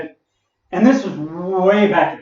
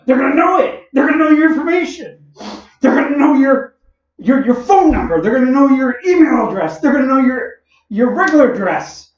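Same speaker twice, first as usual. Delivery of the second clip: mock panic; 10 semitones higher, 6 dB louder than usual. Son mocking dad's fears.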